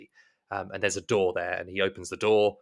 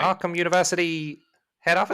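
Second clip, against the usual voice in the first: tag operator; 7 semitones higher than usual. Acted. Operator asks back.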